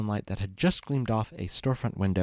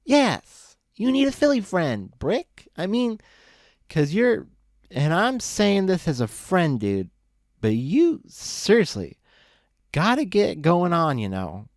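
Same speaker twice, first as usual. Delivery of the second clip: put-on high, sloppy drunk pony voice, much higher; drunk Loose Leaf; 8 semitones higher, 5 dB louder, slower than usual. Drunk buddy lecturing Brick on the road, high slurry drunk voice. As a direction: ramble like a happy drunk friend.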